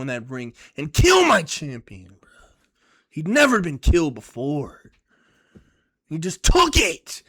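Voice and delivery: loud, slow, each word pushed; fired up. Real, not acted. A fan reliving his champion's knockout, slow and loud. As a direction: hammer it out, furious. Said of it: "it just gets me so fired up".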